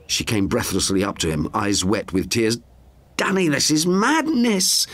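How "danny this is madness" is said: stammered. A narrator's reading. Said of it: "she stammered".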